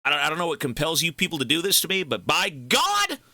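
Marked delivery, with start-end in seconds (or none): 2.0-3.2 slow, rising shout on "God"; emphatic exclamation. Real, not acted. Streamer overwhelmed by viewers' gifts, drawn-out high exclamation. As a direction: draw it out and send it high. Mock-solemn thanks to generous viewers.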